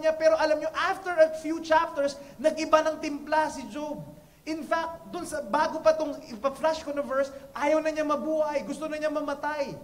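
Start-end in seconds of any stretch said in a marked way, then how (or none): none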